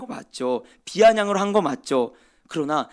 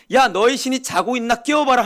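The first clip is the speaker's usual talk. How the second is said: high, louder jeering dare; mocking taunt; 6 semitones higher, 5 dB louder than usual. Elijah taunting Baal's prophets, high scornful jeer. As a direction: sneer at their sleeping god. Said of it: "조롱하는 것처럼".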